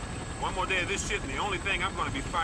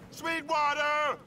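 long drawn-out call, much higher; 10 semitones higher, much slower than usual. Acted. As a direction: shout the name across the field, searching. Sergeant calling for missing soldier.

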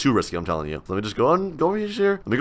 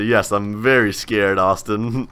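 slow sarcastic "I'm so scared"; drawn-out fake fear, teasing; mock scared reply; 6 semitones lower, 4 dB quieter, slower than usual. Replying to a chat taunt, slow mock-scared sarcasm. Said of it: "I'm gonna say".

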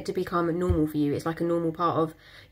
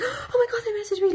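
very high, giddy imitated squeal; mock squeal. Mocking a woman thrilled her crush texted, high giddy squeal. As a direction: squeal it like a starstruck fan. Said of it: "Take them off the pedestal like".

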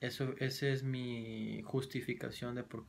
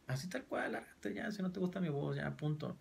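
exasperated retort; 6 semitones higher, 3 dB quieter than usual. Real, not acted. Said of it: "annoyed retort".

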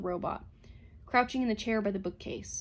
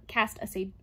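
ordinary delivery

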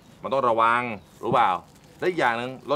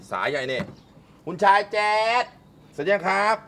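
ordinary talk

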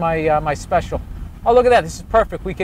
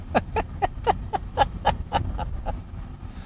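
long breathy laugh; big laugh; 9 dB quieter than usual. Real, not acted. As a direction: break up laughing. Big laugh at own joke.

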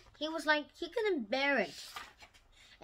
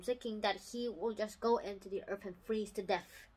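ordinary delivery, nothing marked

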